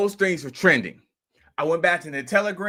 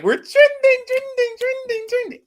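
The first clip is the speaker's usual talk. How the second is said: very high, loud cry of joy fading down; excited shout; 6 dB louder than usual. Retold or imitated. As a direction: scream it like your coins just mooned. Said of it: "So then I'm like".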